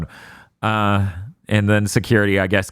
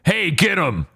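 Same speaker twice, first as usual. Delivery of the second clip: put-on higher-pitched waiter calling out, accent gone; acted order to seize someone, no foreign accent; waiter dropping his accent; 8 semitones higher than usual. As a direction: call out sharply as the unmasked waiter. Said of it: "the waiter drops his accent entirely".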